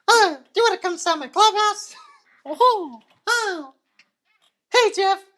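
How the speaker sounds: very high, laughing squeaky voice; chirpy cartoon host. Goofing around in a very high cartoon voice.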